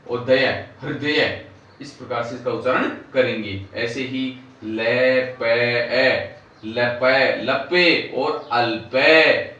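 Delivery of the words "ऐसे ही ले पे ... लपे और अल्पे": ले पे and अल्पे drawn out very slowly; slow, careful classroom spelling of अल्पे; slow spelled syllables. Shown as a demonstration. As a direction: slowly build अल्पे from its parts. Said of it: "इस प्रकार से ये नया शब्द".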